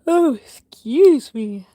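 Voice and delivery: slow words stretched by a yawn. Real, not acted. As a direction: talk through a yawn, slowly. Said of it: "I apologize for yawning".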